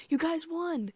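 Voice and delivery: high-pitched happy exclamation; excited congratulation. A narrator's reading. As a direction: cry it out, thrilled for friends. Cheering the twins' winning bet.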